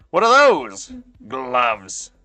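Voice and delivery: high, loud and slow mock disbelief; hammed-up scoffing at finger gloves; mock incredulous. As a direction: drag it out like the idea is absurd. Mocking chat's hobby gloves.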